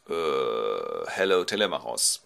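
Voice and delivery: low hesitant 'uh', then plain greeting; king's reply. Acted. Menelaus greets the visiting son, lightly voiced low hesitation. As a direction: answer with a low, hesitant start. King answers the boy, acted.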